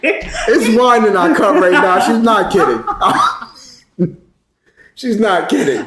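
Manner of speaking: cracking up while talking; real amused laughter breaking into speech; laughing. Real, not acted. Cracking up at co-host's joke.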